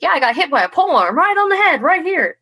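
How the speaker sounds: excited boast. Retold or imitated. Fighter showing a newcomer her old helmet, excited. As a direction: rattle it off high with gleeful pride. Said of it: "they were so excited".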